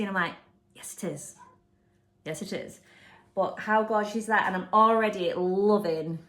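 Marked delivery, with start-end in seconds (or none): 0.7-2.8 whispered, sarcastic agreement under the breath; dry whisper. Retold or imitated. To people who call her name easy, a dry sarcastic whisper. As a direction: mutter it back like you can't believe them. Mock reply to easy spelling.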